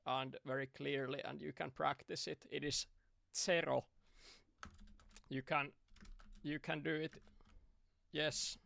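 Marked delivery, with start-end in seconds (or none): 3.4-3.9 "Zero" slow and clear as Finnish "sero"; "Zero" spelled out carefully, SE-ro, rolled r; over enunciating. Shown as a demonstration. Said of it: "I say it very slowly and very clearly".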